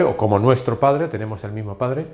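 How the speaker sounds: usual speaking voice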